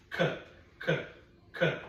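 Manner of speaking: "K" as a short repeated k sound, no vowel after it; isolated k sound. Shown as a demonstration. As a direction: tap out the "k" sound for a child. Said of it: "What is that first sound that you hear?".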